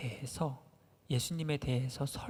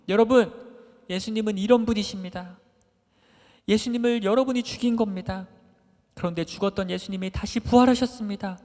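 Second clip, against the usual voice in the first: louder, raised preaching voice to the crowd; Peter preaching; 4 semitones higher, 5 dB louder than usual. Retold or imitated. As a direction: preach it boldly, calling the crowd to believe. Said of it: "이렇게". (군중 앞에서 설교하는 베드로, 높고 힘찬 목소리로)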